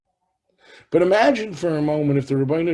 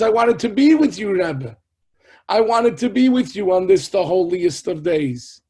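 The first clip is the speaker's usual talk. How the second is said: raised, aggrieved plea; pleading lament; 4 semitones higher, 8 dB louder than usual. Retold or imitated. Heartbroken disciple confessing to his Rebbe, loud pleading. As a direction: plead loudly with heartfelt regret.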